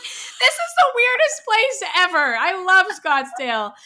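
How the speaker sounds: high, loud and slowed, laughing through it; giddy delight. Retold or imitated. Telling friend her reaction to Scottsdale, high giddy gush. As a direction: gush with amused disbelief. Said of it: "And I'm like".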